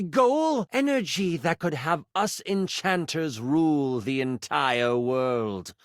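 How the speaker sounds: theatrical villain's monologue, slow and savoured; Melville. Acted. Game master monologues as a villain dreaming of ruling the world, grandiose. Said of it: "monologue as Melville".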